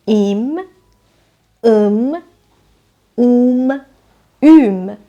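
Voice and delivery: each suffix spoken alone and clearly, with a pause between; suffixes said one by one. Shown as a demonstration. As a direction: say ایم، ام، اوم، اوم as separate crisp sounds. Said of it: "پسوند". (پسوندها را جدا و شمرده بگو)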